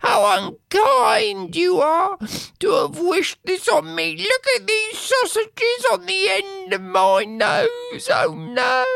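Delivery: very high reproachful wife; high, wailing wife. Acted. Wife reproaching her husband, high wailing voice. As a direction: plead and reproach him.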